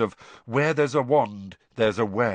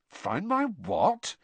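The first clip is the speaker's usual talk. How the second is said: confused; 6 semitones higher, 4 dB quieter, much slower than usual. Acted. Harry confused.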